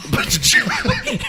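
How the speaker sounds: high, squealing laugh through the words; laughing hard. Real, not acted. Student laughing while retelling his rant to the teacher, high squealing laugh.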